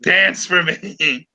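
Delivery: slow, drawn-out villain command; gloating puppet master. Pretend. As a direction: stretch each word like a mock tyrant toying with puppets. Plays gleeful puppet master, Nero image.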